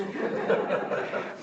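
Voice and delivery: soft, airy laugh at the punchline; breathy chuckle. Real, not acted. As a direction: chuckle quietly at the joke.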